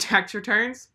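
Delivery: ordinary talk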